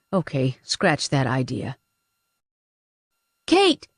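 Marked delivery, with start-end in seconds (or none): 3.5-3.9 high, anxious cry of a name; frightened call. Acted. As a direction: call out for help. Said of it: "Kira asked".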